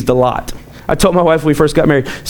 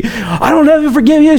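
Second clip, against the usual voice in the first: mock angry lady; 8 semitones higher than usual. Imitating the cussing lady driver with a higher voice. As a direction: toss off her retort in a high voice.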